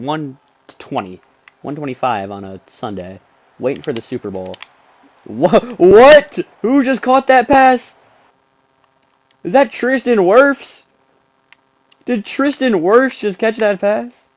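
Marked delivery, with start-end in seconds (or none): 5.3-14.3 high, slow stunned questions; can't believe who caught it; shocked disbelief. Real, not acted. Shocked at the pass catcher.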